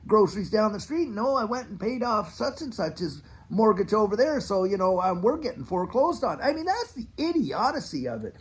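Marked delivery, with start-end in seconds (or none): 0.0-6.4 higher, fumbling mock apology to the kids; sheepish apologetic parent. Parent telling hungry children the money went elsewhere, mock sheepish excuse. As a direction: play the guilty parent making lame excuses. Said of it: "and you're saying".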